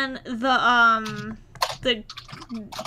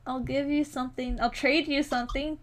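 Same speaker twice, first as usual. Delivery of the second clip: coaxing; 5 dB quieter than usual. Acted. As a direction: gently sweet-talk the cat. Coaxing the cat gently.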